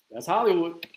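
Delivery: ordinary talk, nothing marked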